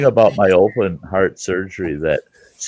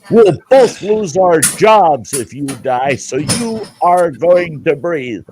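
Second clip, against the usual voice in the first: slow, firm nurse voice, a bit higher; stern nurse; 5 semitones higher, slower than usual. Retold or imitated. Nurse telling the patient he must breathe, slow and firm. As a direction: lay down the law slowly. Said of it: "She goes".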